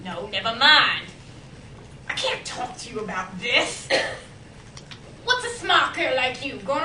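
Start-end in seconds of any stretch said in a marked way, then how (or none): 0.0-1.1 loud, emphatic brush-off; firm refusal. Acted. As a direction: shut the subject down forcefully. Old woman refuses more firmly.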